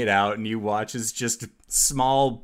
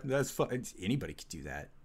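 low, very soft grumble; sour muttering; 5 semitones lower, 11 dB quieter, much faster than usual. Acted. Jealous onlooker as everyone cheers someone else, sour muttering under his breath. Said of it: "mutter under his breath".

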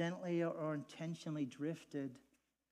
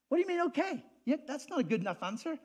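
indignant pushback; 11 semitones higher, 9 dB louder, faster than usual. Retold or imitated. Acting out the questioner's pushback.

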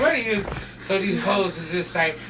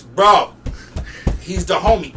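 insisting; 3 semitones higher, 8 dB louder, slower than usual. Retold or imitated. Jack insisting.

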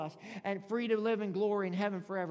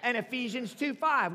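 ordinary delivery

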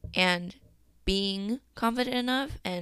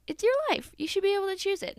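much higher and louder, rushing the words; excited insistence; 12 semitones higher, 4 dB louder, faster than usual. Real, not acted. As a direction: pitch jumps up as she gets fired up. Emphatic point about the song.

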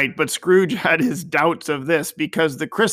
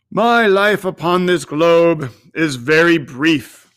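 a louder, otherworldly spirit voice; ghost of Christmas Present; 6 dB louder than usual. A narrator's reading. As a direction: speak as an aging ghost, grave and full. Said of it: "replied the ghost".

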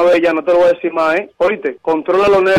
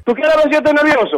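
pitched-up angry retort; indignant high shout; 8 semitones higher than usual. Real, not acted. Victim snaps back at being called nervous. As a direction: challenge him, outraged.

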